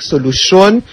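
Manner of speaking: ordinary delivery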